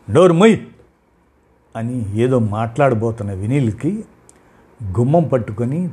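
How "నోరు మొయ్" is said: loud, higher barked command; angry shout. A narrator's reading. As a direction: cut her off with a yell. Husband shuts wife up. (గట్టిగా అరుస్తూ)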